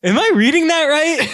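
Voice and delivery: high, loud stunned question; can't believe his eyes; shocked disbelief. Retold or imitated. Telling friends he was locked in, high shocked disbelief.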